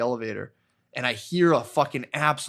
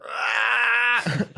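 imitated shout; 9 semitones higher, 3 dB louder than usual. Spud in blankets just going.

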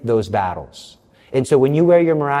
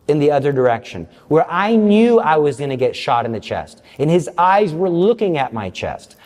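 ordinary delivery

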